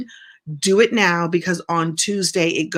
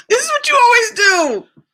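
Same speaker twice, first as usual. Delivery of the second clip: much higher and louder exasperated scolding; exasperated shout; 6 dB louder than usual. Real, not acted. Host yells at someone off-camera, exasperated shout. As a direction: yell it out in exasperation.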